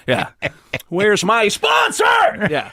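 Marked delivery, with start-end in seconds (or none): 0.9-2.0 high, slow, drawn-out shout; impression scream. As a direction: scream it out in a weird cadence. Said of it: "it's not so much the screaming part".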